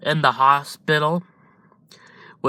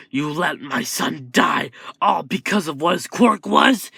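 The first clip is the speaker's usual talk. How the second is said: angry father; 4 semitones higher than usual. Acted. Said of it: "punches him".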